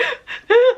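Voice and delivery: loud high gasp between sob and laugh; half-laughing imitated sob gasp; sobbing gasp. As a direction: gulp air like full-on sobbing. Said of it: "I'm talking sobbing".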